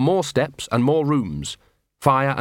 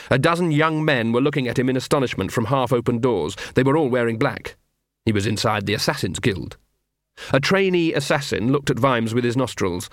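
plain reading voice

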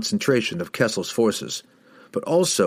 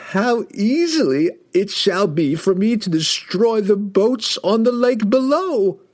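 very high, grandiose villain voice; Kessel, magnified voice; 12 semitones higher, 4 dB louder than usual. A narrator's reading. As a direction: gloat like an all-powerful wizard, climbing ever higher. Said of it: "his voice magnified to deafening proportions".

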